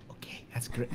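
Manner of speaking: breathy whispered sarcasm; whispered okay. Retold or imitated. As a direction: mutter it unvoiced, deadpan. Retold parents' indifferent remark.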